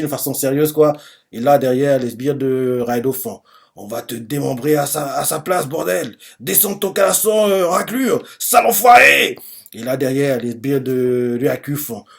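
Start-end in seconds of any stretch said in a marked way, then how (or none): 3.8-9.4 mounting henchman's outrage; angry insults. Acted. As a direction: start contained, end in an acted shout.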